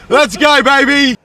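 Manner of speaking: very high, loud whoop; real hyped cheer. Real, not acted. Mate hyping up the boys, excited shout.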